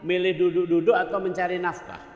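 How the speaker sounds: fiery sermon questions thrown at the listeners; forceful preaching. Acted.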